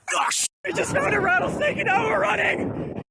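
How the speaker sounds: normal talking voice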